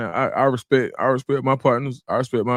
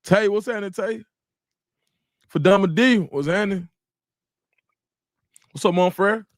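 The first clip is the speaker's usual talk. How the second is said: hype greeting; 7 semitones higher than usual. Real, not acted. Shout-outs to chat viewers.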